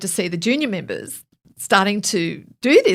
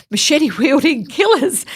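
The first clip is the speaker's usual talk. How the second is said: laughing; 7 semitones higher, 5 dB louder than usual. Real, not acted. Laughing at her own retort.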